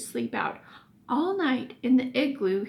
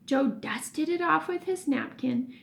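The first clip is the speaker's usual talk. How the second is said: ordinary delivery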